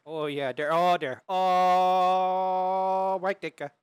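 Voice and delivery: hammed-up Wisconsin yokel accent. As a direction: stretch a big round Wisconsin "oh". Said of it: "that Wisconsin accent".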